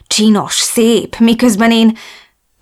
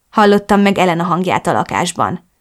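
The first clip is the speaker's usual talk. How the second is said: ordinary delivery, nothing marked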